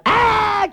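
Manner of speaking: very high frightened shriek; high mock scream; imitated kid scream. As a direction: scream like a child fleeing.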